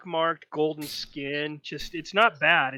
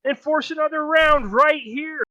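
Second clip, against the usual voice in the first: high, loud game-show call; big ringing host voice, pitched high; excited announcer; 12 semitones higher, 7 dB louder than usual. Acted. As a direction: announce it over a roaring crowd. Game host announces to roaring crowd.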